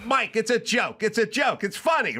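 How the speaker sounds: high-pitched exasperated pleading; exasperated insisting. Retold or imitated. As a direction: insist it, at your wits' end. Exasperated explaining to Mike.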